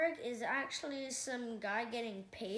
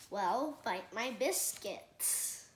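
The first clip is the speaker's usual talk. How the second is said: slow, drawn-out catchphrase delivery; much slower than usual. Acted. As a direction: savour each word like a TV star's signature line. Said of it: "a catchphrase of my own".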